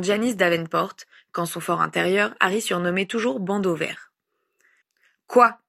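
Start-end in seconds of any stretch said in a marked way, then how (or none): 5.3-5.6 loud, curt one-word challenge; brusque, unwelcoming tone; unfriendly. A narrator's reading. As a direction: answer as if unwilling to waste time. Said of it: "d'une voix peu amène".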